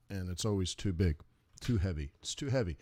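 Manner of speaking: normal talking voice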